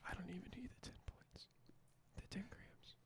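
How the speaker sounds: breathy secret whisper to the mic; giggly prank whisper. Real, not acted. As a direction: whisper it like a cheeky confession. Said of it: "Why are you whispering into the mic?".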